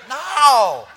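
a loud, high, laughing no; emphatic no. Acted. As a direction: shut it down with a big no. Emphatic answer to own question.

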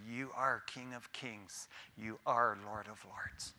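dropping to a soft hush; hushed quoted confession. Acted. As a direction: voice of the defeated, softly. Quoting the condemned bowing.

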